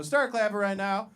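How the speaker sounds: applause cue. Real, not acted. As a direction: start rallying the crowd, holding some back. Host begins working up applause.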